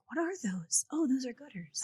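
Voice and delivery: whispered mock wonder; hushed imitation. Out-of-town buyers spotting gutters, hushed mock wonder. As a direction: whisper it like a stunned onlooker.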